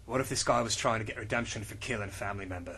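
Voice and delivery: low, quiet, fast brooding hero; low, soft, quick tough-guy read; John Constantine voice. Acted. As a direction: mutter it like a weary detective.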